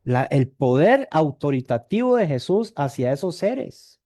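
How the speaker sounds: usual speaking voice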